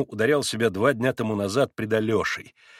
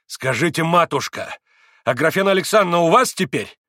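beside himself with anticipation; 5 semitones higher, 6 dB louder than usual. A narrator's reading. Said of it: "Вне себя от ожидания произнёс Митя".

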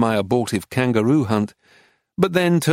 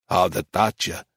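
lazy, lower drawl as Titus; lower, unhurried drawl; 5 semitones lower, 3 dB quieter than usual. Acted. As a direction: drawl it out, amused. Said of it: "he drawled".

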